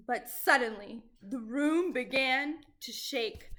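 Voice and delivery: ordinary delivery, nothing marked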